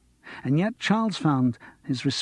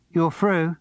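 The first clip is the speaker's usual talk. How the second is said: Cockney; 5 semitones higher, 3 dB louder than usual. Acted. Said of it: "They were all Cockney".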